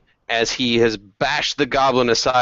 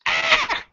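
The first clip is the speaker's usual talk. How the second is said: very high, short goblin shriek; little goblin scream. Acted. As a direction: squeal like a startled little goblin. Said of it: "He screams his little goblin scream".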